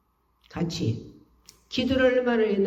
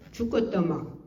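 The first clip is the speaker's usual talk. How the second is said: usual speaking voice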